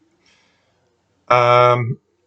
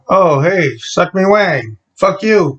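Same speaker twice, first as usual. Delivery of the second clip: higher, slower cheery hello to a passer-by; mock friendly greeting; 6 semitones higher, slower than usual. Greeting a man named Wang in the street, hammed-up cheer. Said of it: "that was just some guy's name".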